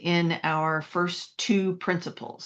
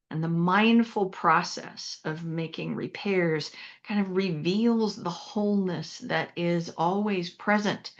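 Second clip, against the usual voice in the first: usual speaking voice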